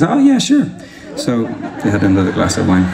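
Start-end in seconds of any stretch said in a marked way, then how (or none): 0.0-0.7 much higher, lilting acceptance; eager yes. Retold or imitated. Woman accepting another glass, high-pitched easy yes. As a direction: pipe up high and agreeable. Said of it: "she was more relaxed and more open".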